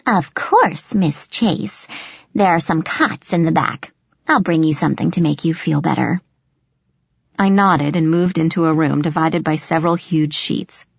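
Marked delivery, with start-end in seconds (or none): 0.1-6.2 swooping, gravelly character voice; gravelly old nurse. A narrator's reading. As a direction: humour the student in the old nurse's croak. Nurse's character voice in narrated dialogue.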